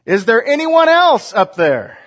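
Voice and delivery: high, loud panicked call; yelled. Acted. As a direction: shout up for anyone else. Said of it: "Then the tourist yelled".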